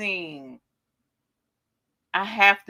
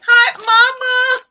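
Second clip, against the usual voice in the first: excited greeting; 10 dB louder, much slower than usual. Real, not acted. Greets mother again.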